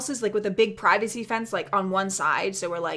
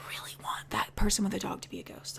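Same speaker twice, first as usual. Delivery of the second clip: hushed confiding whisper; whispered wish; 11 dB quieter than usual. Real, not acted. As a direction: whisper it like a secret.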